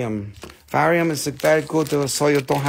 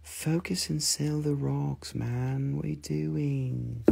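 softer, slow stern scolding; mock scolding Nicole; 10 dB quieter, much slower than usual. Nicole in the back telling Rusty to focus, soft, slow scolding. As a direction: scold him quietly and slowly. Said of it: "She said".

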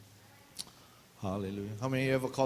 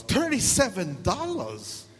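high, loud and slow, outraged at the price; shocked outrage; 7 semitones higher, 5 dB louder, slower than usual. Real, not acted. Pastor outraged at the nurse's copay bill, loud and high. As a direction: drag it out in disbelief.

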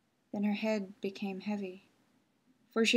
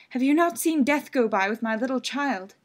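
louder anxious asking; pleading mother; 3 semitones higher, 8 dB louder than usual. Acted. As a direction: ask it desperately, searching. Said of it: "she asked".

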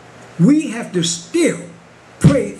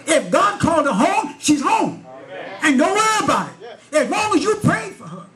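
preaching at full force; 8 semitones higher than usual. Acted. Preacher retells the pastor's rebuke to his church, preaching. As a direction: lift it higher and higher to the congregation.

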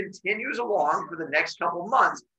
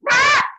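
short, loud, very high squawk; imitated newborn yelp; baby's cry; 10 dB louder than usual. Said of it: "baby goes".

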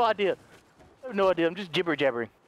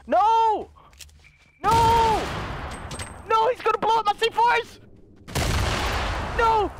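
anguished shout; 11 semitones higher, slower than usual. Real, not acted. Enemy blows up his C4.

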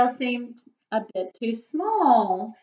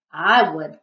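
creaky coral voice; 4 semitones lower, 6 dB louder than usual. A narrator's reading. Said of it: "creaked the crusty coral".

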